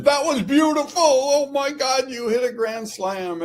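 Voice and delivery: high, delighted gush; thrilled producer. Retold or imitated. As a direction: rave like you just saw a home run. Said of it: "John came running in".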